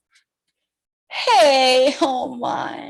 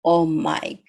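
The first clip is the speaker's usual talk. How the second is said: very low, slow disbelief; mock dismay; 12 semitones lower, 4 dB quieter, slower than usual. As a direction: drag it out deep and slow. Dismayed at the hen in story.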